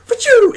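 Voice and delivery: high, loud comic "achoo" sneeze; mock sneeze sound. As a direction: blurt a cartoon sneeze. [name] force-throws arrow back.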